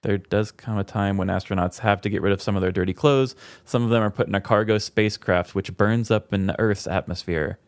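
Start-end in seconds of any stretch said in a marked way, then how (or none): none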